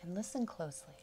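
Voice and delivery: slow, calm meditation voice with long pauses; unhurried yoga nidra guidance, settling softly; guided meditation. Acted. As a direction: ease them gently into relaxation, phrase by phrase.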